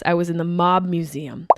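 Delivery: usual speaking voice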